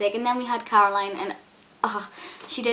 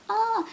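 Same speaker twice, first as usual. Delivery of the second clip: excited squeal; 12 semitones higher than usual. Retold or imitated. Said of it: "I was like".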